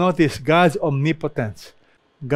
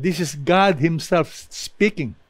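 ordinary talk

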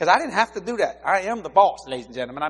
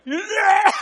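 much higher, loud yelp; shocked yell; 8 dB louder than usual. Retold or imitated. Boss seeing the wrecked van, loud yelp. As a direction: yelp at the sight of the damage.

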